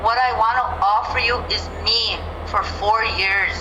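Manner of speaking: ordinary talk, nothing marked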